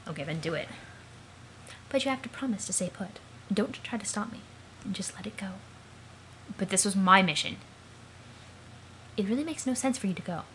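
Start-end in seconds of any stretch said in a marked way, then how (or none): 3.5-5.5 soft pleading whisper. Acted. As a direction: breathe the words, hold them back. Pleading not to be stopped.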